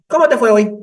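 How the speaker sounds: high, loud mother voice, stress on cómo; mother's question again. Retold or imitated. Psychologist shows how the mother asks, high and loud. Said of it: "Así".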